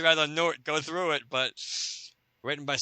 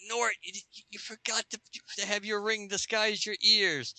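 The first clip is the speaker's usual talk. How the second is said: put-on Sax Girl whispering; 8 semitones higher, 3 dB quieter than usual. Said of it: "trying to whisper to him".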